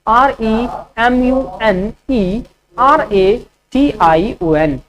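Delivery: slow letter-by-letter dictation; slow spelling dictation; spelling out slowly. Shown as a demonstration. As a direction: spell each letter clearly for students. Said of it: "रिमनरेशन का क्या spelling होगा".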